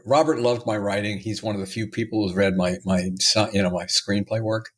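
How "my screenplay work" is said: usual speaking voice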